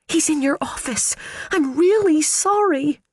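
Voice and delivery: put-on high, panicky girlish voice; nervous high little voice; timid young secretary. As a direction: squeak out an anxious apology. Phoebe confessing to Ellen.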